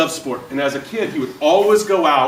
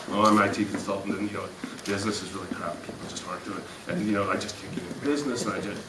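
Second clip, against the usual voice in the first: low, much softer, defeated moaning; mopey lower mumble of a failing businessman; gloomy moaner; 9 semitones lower, 11 dB quieter, faster than usual. Acted. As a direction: sound like a deflated man who's given up. Gloomy consultant voiced in example.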